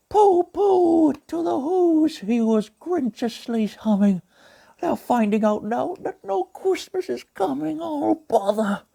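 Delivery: gentle cartoon bear narrating; Pooh Bear voice. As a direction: read it as a gentle honey-loving bear. Pooh Bear reads the story.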